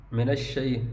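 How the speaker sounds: ordinary delivery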